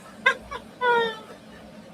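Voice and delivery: squeaky fit of giggles; high giggle. Real, not acted. Crafter cracks up at a sticker, high giggle. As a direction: break into a high helpless giggle.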